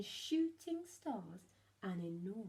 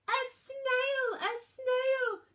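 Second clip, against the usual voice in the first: shocked shriek; 10 dB louder, much slower than usual. A narrator's reading. Teacher shocked by snail on board.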